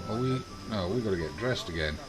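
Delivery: normal talking voice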